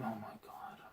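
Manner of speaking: breathless horrified whisper; shocked whisper. Real, not acted. Reading the manga, a horrified whispered aside. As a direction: whisper it under your breath.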